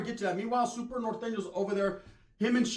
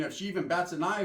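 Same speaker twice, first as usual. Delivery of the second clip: ordinary talk, nothing marked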